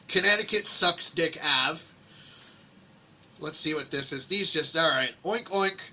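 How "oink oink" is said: mock pig oink. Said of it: "that's what the pig says".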